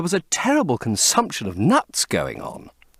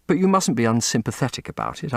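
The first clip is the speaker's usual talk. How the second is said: ordinary talk, nothing marked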